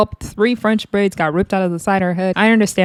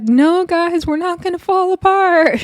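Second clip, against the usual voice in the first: high mock plea; 11 semitones higher than usual. Jaden pleading for unity.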